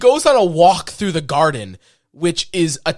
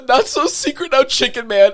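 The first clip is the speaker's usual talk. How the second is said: quoting the taunt; 7 semitones higher than usual. Retold or imitated. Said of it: "is the best line of the whole movie".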